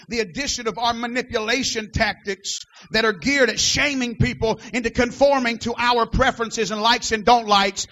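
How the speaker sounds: building to a loud preached climax over the organ; fiery sermon climax, shouting it out; preaching at full force. Real, not acted. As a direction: rise from firm to shouting, hammer each line. Preaching at full force, music behind.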